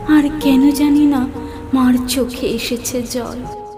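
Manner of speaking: ordinary talk, nothing marked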